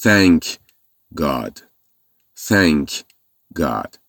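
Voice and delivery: teacher modelling thank God; correct pronunciation. Shown as a demonstration. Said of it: "باید بگین".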